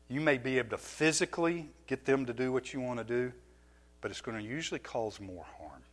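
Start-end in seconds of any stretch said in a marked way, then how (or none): none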